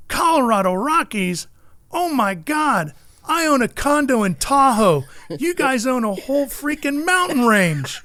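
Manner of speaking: high, emphatic imitation of earnest amazement; John Force, dead serious. Racer meets two Rockies part owners, earnestly amazed. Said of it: "dead serious".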